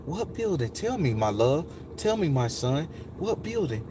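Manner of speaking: imitating a tender woman, hushed; quiet, gentle questioning; soft, loving lady. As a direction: coax softly with endearments. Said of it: "that lady was gonna be the softest".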